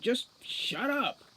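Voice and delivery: angry. Acted. Butler snaps at nervous Grubb.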